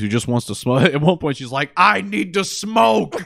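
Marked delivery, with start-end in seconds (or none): 1.7-3.2 desperate craving. Retold or imitated. Woman trapped in the cube craves a smoke, mock-desperate. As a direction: mock-desperate wail, high and drawn out. Acting out character craving a cigarette.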